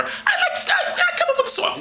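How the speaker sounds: high, laughing sarcasm; mock giggle. Comic mocking the Israelites' song, high and laughing.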